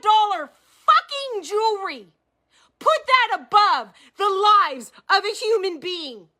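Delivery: high, hard-pushed angry demands; fired up. Real, not acted. Demanding answers about the company, furious. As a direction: bark the questions in real outrage. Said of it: "I'm fired up".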